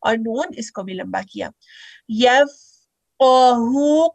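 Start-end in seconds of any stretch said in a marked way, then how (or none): 2.1-4.1 drawn-out chanted यफक़हू; slow recitation. Shown as a demonstration. Teacher reciting the word for students.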